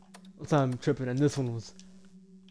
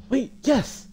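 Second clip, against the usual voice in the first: excited; 10 semitones higher, 6 dB louder than usual. Real, not acted. Finds the way out.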